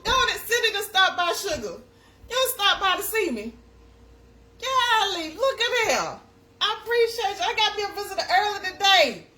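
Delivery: high, loud, delighted welcome; light up at a friend dropping by; thrilled hello to classmate; excited greeting. Real, not acted. Excited greeting visitor arrives.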